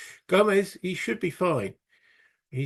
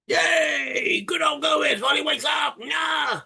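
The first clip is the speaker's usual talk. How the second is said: high, excited mock-Spanish shout; dodgy Spanish accent; 13 semitones higher, 3 dB louder, slower than usual. Player cheering Gomez waking up, dodgy Spanish accent. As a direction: cheer shrilly in a badly faked Spanish accent. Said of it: "that's a dodgy Spanish accent".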